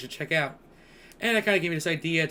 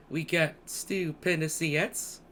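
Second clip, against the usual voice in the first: usual speaking voice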